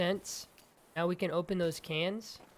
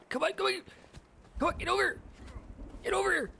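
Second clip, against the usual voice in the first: strained squeak; 13 semitones higher, faster than usual. Real, not acted. Straining to climb.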